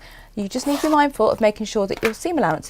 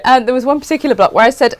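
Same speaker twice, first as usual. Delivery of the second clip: usual speaking voice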